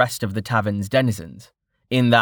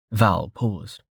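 ordinary delivery, nothing marked

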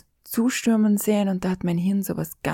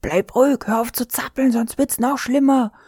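higher and louder, a retold shout at her brother; retold yell; 5 semitones higher, 3 dB louder than usual. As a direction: bark the order at a panicking boy. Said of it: "brüllte ihn an".

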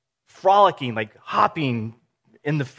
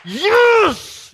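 big high shout of joy; cheering fan; 9 dB louder than usual. Retold or imitated. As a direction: yell like your team just scored. Team scores on TV.

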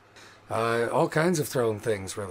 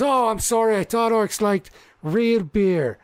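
high, loud teasing sarcasm; goading mock apology; 9 semitones higher, 6 dB louder than usual. Halfling baiting orcs about beer, loud mock apology. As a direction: needle the crowd with false regret. Halfling goads the orc crowd.

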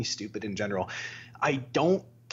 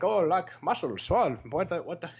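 mock Indian accent, raised pitch; 5 semitones higher than usual. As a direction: imitate online commenters in an Indian accent. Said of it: "like, fucking India".

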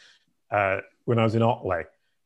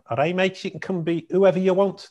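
weak Scouse accent, lightly put on; a bad Liverpool accent; 7 semitones higher than usual. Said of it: "my really crap Liverpool accent".

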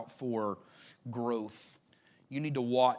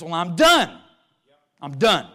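loud, fed-up voice saying "Well, I'm done"; defeated believer; 3 semitones higher, 11 dB louder, slower than usual. Acted. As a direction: throw the words down like someone quitting. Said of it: "attitude that just says".

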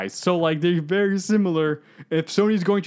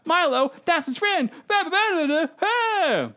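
mock dramatic gasp; 12 semitones higher, slower than usual.

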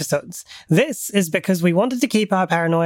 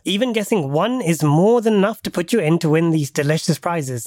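ordinary talk

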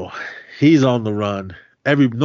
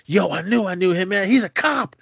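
alarmed realisation; 9 semitones higher than usual. Retold or imitated. Kareem recognising the cop.

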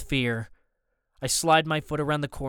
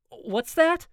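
high-pitched, distorted query; 10 semitones higher than usual. A narrator's reading. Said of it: "his voice distorted".

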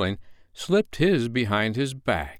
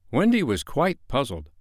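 ordinary delivery

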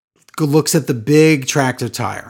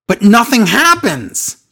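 incredulous; 11 semitones higher, 3 dB louder than usual. Real, not acted. Baffled by pointless tire close-up.

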